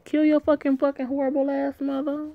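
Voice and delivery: high, outraged disbelief; shocked disbelief. Real, not acted. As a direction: squeal it in appalled disbelief. Reader's shocked aside.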